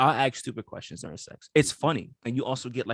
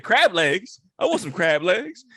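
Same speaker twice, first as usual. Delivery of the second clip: louder and higher, greedy for crab legs; eager; 7 semitones higher, 9 dB louder, slower than usual. Retold or imitated. Likening himself to a man at a buffet, eager call.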